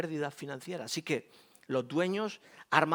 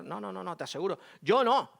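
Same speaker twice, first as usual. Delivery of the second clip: ordinary talk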